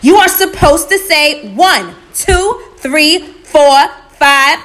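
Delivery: very loud, high exasperated yell; high-pitched furious shouting. A narrator's reading. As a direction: shout the count out, word by word. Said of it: "Anansi shouted".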